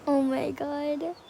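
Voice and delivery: ordinary talk, nothing marked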